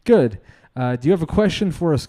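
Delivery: normal talking voice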